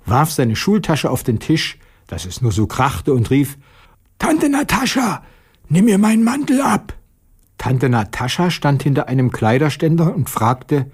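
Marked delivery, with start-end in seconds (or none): 4.2-6.9 high, eager schoolboy calling out; a bright child's voice, calling to the cloakroom lady; boy's voice. Acted. Late schoolboy calling to Aunt Natascha, high child voice. Boy Petja calls out at school.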